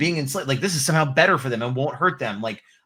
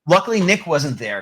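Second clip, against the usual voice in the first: usual speaking voice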